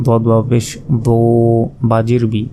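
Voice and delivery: ordinary delivery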